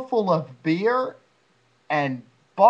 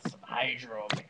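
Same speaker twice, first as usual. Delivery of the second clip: whisper; 7 semitones lower, 11 dB quieter, much slower than usual. Real, not acted. Whispered reply.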